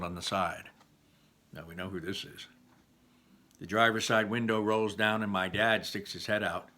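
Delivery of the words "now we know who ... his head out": plain reading voice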